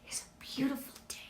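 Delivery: whispered, almost secret; hushed whisper. Real, not acted. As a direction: whisper it like good news. Uplifting aside to the audience.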